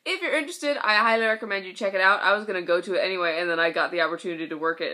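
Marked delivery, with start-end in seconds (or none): none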